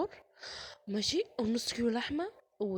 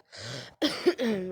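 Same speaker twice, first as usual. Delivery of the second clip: loud chesty cough; real cough; 8 dB louder than usual. Real, not acted. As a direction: cough hard into the mic. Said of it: "صوتي وحش قوي". (كحة جامدة حقيقية)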